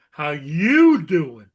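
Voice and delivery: slow, loud Joey-style New York greeting; New York accent. Shown as a demonstration. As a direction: drag it out like a Brooklyn guy. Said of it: "I think the correct accent is".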